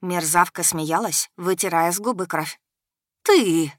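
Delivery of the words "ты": loud, venomous; hissed. A narrator's reading. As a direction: spit the word. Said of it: "зашипела Агая". (зашипела)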